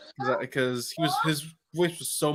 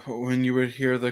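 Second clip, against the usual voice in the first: ordinary talk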